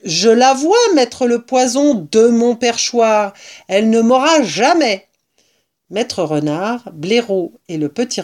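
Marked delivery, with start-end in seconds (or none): 0.0-5.1 defiant boast. A narrator's reading. Rat boasts from its perch.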